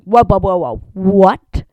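startled stop-right-there; shocked. Retold or imitated. Owner hearing surgery news, loud alarm. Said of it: "And I was like".

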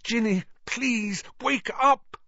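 high, pleading voice; urgent high pleading cry; desperate plea. Acted. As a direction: beg her to wake up. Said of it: "Harry muttered desperately".

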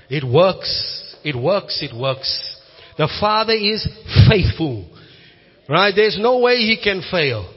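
impassioned pulpit preaching; preaching at full force. Acted. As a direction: build the sermon to a bold climax.

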